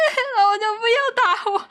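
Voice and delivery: high, fast, whiny mock sob; imitated child crying. Little girl crying at her sister, mock wailing. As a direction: wail like a little kid being hit. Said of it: "然后我就哭".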